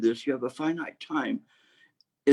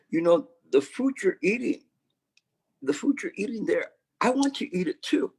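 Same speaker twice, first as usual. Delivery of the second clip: higher and higher, a dog begging for food; 8 semitones higher than usual. Acted. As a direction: climb up in pitch as the dog pleads for the food. Dog begs for master's food.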